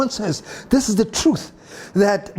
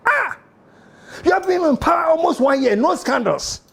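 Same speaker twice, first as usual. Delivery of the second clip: high, fast mocking complaint; a lightly voiced friend moaning; mock NPP friend; 10 semitones higher than usual. NPP friend complaining there are no scandals, mock whine. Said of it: "I said".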